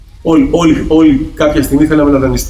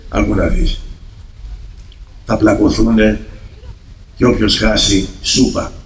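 lightly lowered neighbour voice, soft and weighty; heavy neighbour voice; 5 semitones lower, 5 dB quieter, slower than usual. Retold or imitated. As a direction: deliver the neighbour's punchline low, last word breathed. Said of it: "έχει και βαριά φωνή έτσι".

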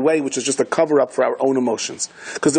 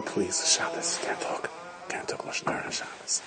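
hushed unvoiced whisper; 4 semitones higher, 14 dB quieter than usual. The Rebbe hushing his angry mother at the table, whispering. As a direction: whisper a plea to keep quiet.

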